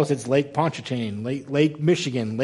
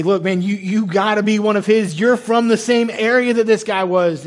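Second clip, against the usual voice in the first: higher, louder, pressing challenge; insistent accusation; 5 semitones higher, 5 dB louder than usual. Acted. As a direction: voice rises as you press him. Voicing Peter's accusers confronting him.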